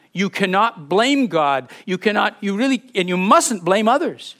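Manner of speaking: usual speaking voice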